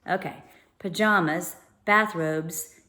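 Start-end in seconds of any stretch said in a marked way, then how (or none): none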